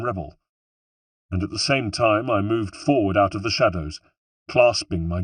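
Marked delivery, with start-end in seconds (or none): none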